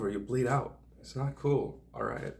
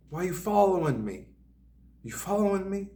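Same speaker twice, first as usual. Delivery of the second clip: high-pitched mimicry of an agitated woman; mock an accuser, pitched way up; accusing stranger; 10 semitones higher, 3 dB louder than usual. Woman confronting the man behind her, high accusing mimicry. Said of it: "and she goes".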